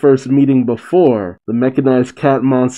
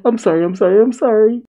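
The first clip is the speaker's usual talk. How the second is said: high, panicked apology; acted freak-out, pitched way up; Rebecca; 10 semitones higher, faster than usual. A narrator's reading. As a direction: squeal and babble apologies in a put-on panic. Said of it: "immediately starts crying and freaking out".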